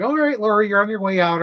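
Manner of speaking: starts high and loud, easing down; acted hospital staff. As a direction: play upbeat staff sending a patient off. Hospital staff voiced to patient.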